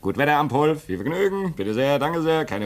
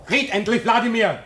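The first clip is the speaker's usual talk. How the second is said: higher, louder, pressing; urgent demand; 13 semitones higher, 4 dB louder than usual. Acted. Impatient man urges his comrade to break the bad news, loud demand. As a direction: push him to speak now.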